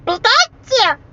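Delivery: squeaky high cartoon creature; Pokemon imitation. Prankster calls from the closet to fool the boy, squeaky creature imitation. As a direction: pipe up in a very high mock creature voice.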